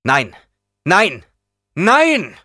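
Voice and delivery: denial building from loud to a high yell; escalating shout. A narrator's reading. Escalating denial after "sagte er laut.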